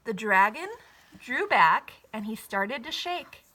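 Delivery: ordinary delivery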